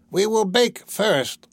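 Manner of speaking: high, slow witch voice; storybook witch, pitched well up; old witch. A narrator's reading. Witch voiced giving orders.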